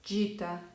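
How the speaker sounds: gita with soft G like dj; Italian soft g. Shown as a demonstration. Teacher models the soft G for students. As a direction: say gita as djita, soft G before I. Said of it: "voy a pronunciar las siguientes palabras como".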